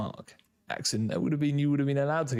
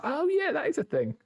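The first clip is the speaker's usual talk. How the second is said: high-pitched mock realisation; sudden recognition. Quizzer imitating someone remembering an old saying, high-pitched recognition. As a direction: act out a forgotten phrase clicking back. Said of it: "You're like".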